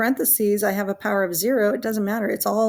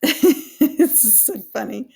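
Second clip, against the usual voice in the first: helpless, high-pitched laughter through the words; slow, high words broken up by giggles; real giggling fit; laughing at herself; 5 semitones higher, 5 dB louder, slower than usual. Real, not acted. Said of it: "I'm laughing at me".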